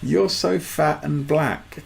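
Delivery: repeated quote. Retold or imitated. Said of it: "They don't give a fuck".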